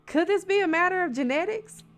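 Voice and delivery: usual speaking voice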